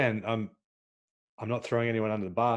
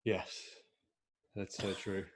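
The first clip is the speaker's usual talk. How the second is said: usual speaking voice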